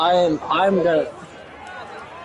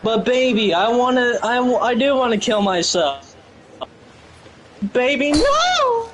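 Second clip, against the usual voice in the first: Diane impression; 4 semitones higher than usual. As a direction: plead high and stretched out. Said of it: "you do the Diane impression".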